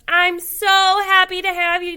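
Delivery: high, loud happy welcome; delighted hostess. A narrator's reading. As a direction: greet the guest with joy. Missus Moose welcomes turkey.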